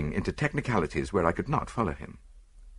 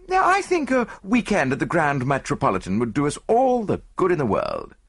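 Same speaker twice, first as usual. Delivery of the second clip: lightly voiced friend with higher pitch; eager friend; 6 semitones higher than usual. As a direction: make the suggestion in a lifted, chatty voice. Voices Hastings making proposal.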